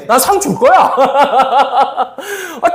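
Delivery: ordinary talk, nothing marked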